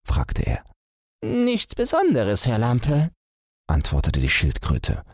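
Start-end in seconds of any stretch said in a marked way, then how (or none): 1.2-3.1 high tortoise voice; pitched-up tortoise, casual reply. Acted. Tortoise answers the hare.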